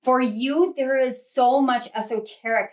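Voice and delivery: ordinary delivery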